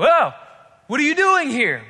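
high, loud cry of alarm; startled demon. Acted. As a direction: blurt it out loudly, caught off guard by a feared enemy. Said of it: "the demon can't help but go".

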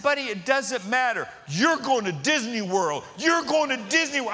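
high, emphatic retold protest; exasperated dad. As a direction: act out a dad fending off the kids. Dad imagines kids' demands, exasperated reply.

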